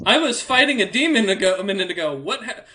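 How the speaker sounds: baffled, trying to make sense of it; confused. Acted. Confused NPC waking up.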